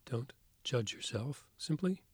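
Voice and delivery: soft spa whisper. Acted. Leads a guided meditation.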